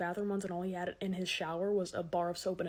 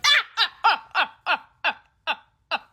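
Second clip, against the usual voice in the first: loud, high staccato laugh; friend's fake laugh; 8 semitones higher, 11 dB louder than usual. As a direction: cackle like a loyal friend. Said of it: "she'll be like".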